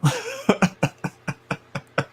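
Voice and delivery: long high-pitched squeal of a laugh. Real, not acted. Laughing at the cheater, high squealing laugh. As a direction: let a shrill laugh run out. Laughing after shoulda stayed loyal.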